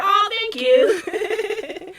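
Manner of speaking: high, drawn-out gushing thanks; touched, sing-song squeal of thanks; delighted thanks. Real, not acted. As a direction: let it stretch out and melt into a laugh. Reacts to praise of her favorite oil.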